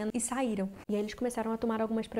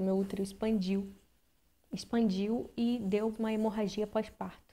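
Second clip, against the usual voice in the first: usual speaking voice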